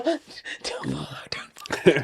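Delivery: whispered plea, gripping tight; whispering. Retold or imitated. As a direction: whisper it like holding on. Gripping the handle in the ride.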